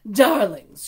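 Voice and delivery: loud, bright trilling call. Acted. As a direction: sing out the greeting grandly. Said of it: "she trilled".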